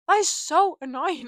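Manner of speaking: loud, high, laughing exasperation; exasperated. Real, not acted. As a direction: burst out complaining through a laugh. Repeats complaint louder, laughing.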